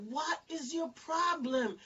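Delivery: high, slow indignant demand; retold exasperated confrontation. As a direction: draw it out, challenging him. Retold confrontation with laughing manager.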